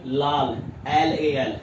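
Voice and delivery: लाल एल ए एल drawn out slowly, letter by letter; slow mnemonic drill. Shown as a demonstration. Teacher showing students how to remember, slowly spelled. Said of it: "कैसे याद रखोगे".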